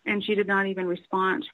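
ordinary talk, nothing marked

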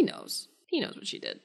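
hushed, breathy aside; whisper. Real, not acted. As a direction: whisper it like a secret. Whispered aside about Giles.

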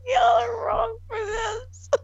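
high-pitched laughing wail; laughing high cry. Real, not acted. Laughing at friends.

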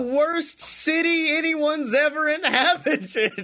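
slow, high mock declaration; imitating Blake. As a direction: ham up a forgotten line. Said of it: "He's like".

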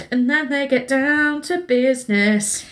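slow, drawn-out innuendo; suggestive. Real, not acted. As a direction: stretch it out knowingly. Coy lead-in to the sex scene.

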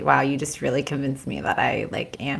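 hushed, breathy aside as if sharing a secret; playful stage whisper; mock whisper. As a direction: lean in and whisper it in jest. Mock-confiding aside to co-host.

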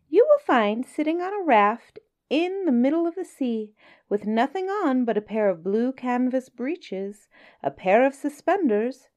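high, sly little fish voice; small astute voice. Acted. Small clever fish flatters the whale, high sly voice. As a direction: sweet-talk the whale in a tiny clever voice.

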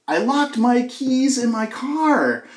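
slow, high-pitched repeated call; retold shouting. Husband repeating himself to his wife, calling out. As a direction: stretch it out as a holler to the next room. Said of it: "So I shouted".

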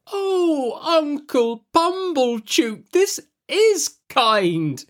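very high, very slow gushing voice; gushing sister. A narrator's reading. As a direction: drag it out in an over-sweet high thank-you. Mrs Joe voiced in story.

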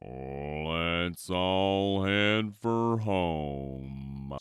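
very slow drawn-out cow; a cow slowly rounding everyone up. A narrator's reading. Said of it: "said Cow".

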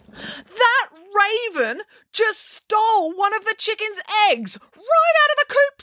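very high, slow indignant cry; outraged outcry. A narrator's reading. Mum telling Tammy a raven stole an egg, outraged cry. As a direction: exclaim it high, drawing out each word.

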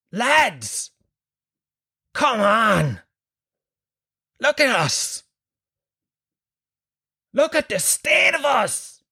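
high, slow, rousing pep talk to the lads; rally the troops with mock passion; rallying speech. [name] rousing the mid-thirties vaccine queue, a high hammed-up rally. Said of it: "I wanted to go like this".

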